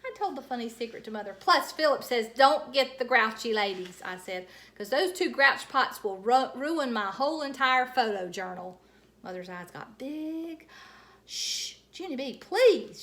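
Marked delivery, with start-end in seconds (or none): 1.3-8.8 louder, gleeful; loud telling. A narrator's reading. Junie B telling Mother the funny secret too loudly. 11.2-13.0 urgent hushing plea; panicked hush. A narrator's reading. Mother panicking as Junie B talks too loud. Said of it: "she said, very panicked".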